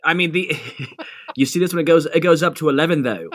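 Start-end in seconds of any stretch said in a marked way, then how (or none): 1.3-3.3 badly faked British accent; terrible British accent. Podcast guest jokes with co-host about eleven, terrible British accent. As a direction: put on a clumsy British voice. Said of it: "that was a terrible British accent".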